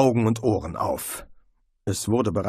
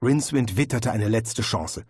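plain reading voice

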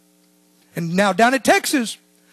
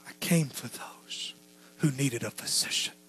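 whispered quote; 10 semitones lower, 14 dB quieter than usual. Acted. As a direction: breathe it like a secret. Preacher whispers Jesus' words.

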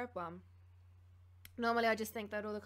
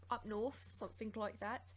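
put-on awful northern accent. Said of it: "that's an awful accent".

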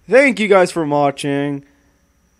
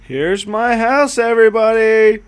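proud presenter; 6 semitones higher, 5 dB louder than usual. Pretend. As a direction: present it grandly. Show-off presenter.

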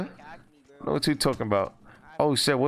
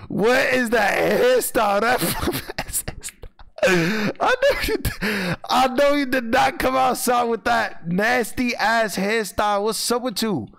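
mocking roast; 7 semitones higher than usual. Real, not acted. Roasting a stranger's hairstyle.

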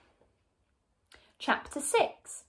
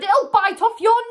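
high, loud scare tactic; alarmed warning; 9 semitones higher, 9 dB louder than usual. A narrator's reading. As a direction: shout it to terrify your wife. Husband frightens his screaming wife.

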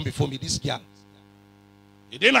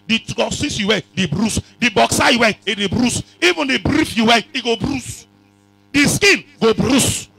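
rising louder and higher each line; building preaching shout; 5 semitones higher, 9 dB louder than usual. Acted. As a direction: build it up from the pulpit.

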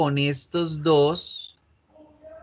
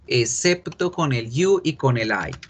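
ordinary talk

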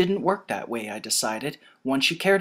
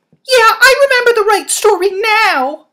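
plain reading voice

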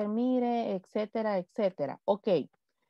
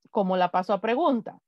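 normal talking voice

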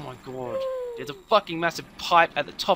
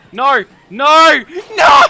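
loud no, rising in panic; despairing shout; 9 semitones higher, 15 dB louder, much slower than usual. Real, not acted. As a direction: cry out in dismay. Game failure.

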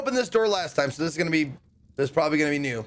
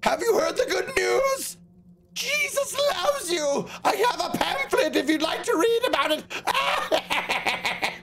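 much higher, slow, laughing street-preacher voice; high-pitched preacher; 12 semitones higher, slower than usual. Pretend. Player hijacks the old man's line as a giggling high preacher. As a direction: hand out pamphlets in a squeaky, giggly zealot voice. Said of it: "In a high-pitched voice, he says".

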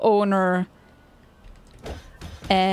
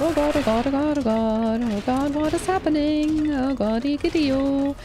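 rapid-fire babbled cries for help; playful panic; 3 semitones higher than usual. Real, not acted. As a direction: chant it in a running panic, pitch sliding about. Panicking under attack in game.

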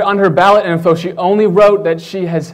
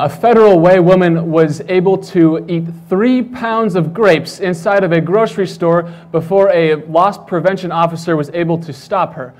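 normal talking voice

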